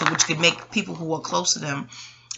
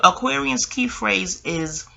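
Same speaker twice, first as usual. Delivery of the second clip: ordinary delivery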